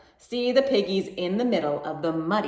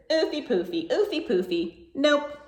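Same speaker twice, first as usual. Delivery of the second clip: cheeky piggy; 7 semitones higher than usual. A narrator's reading. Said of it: "But the piggy answered".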